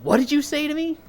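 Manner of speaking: high-pitched mock indignation; imitated offended challenge. Second guy squaring up to his insulter, mock-offended. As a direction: square up with a rising, affronted question. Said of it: "starting to throw insults at each other".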